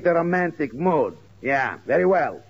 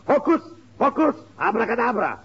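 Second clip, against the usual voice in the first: magic incantation; 8 semitones higher than usual. Acted. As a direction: cast the spell with a flourish. Pirate undoes the spell.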